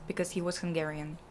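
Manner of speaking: usual speaking voice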